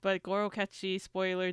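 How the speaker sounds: usual speaking voice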